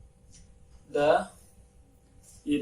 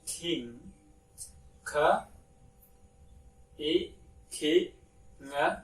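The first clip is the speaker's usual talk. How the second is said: usual speaking voice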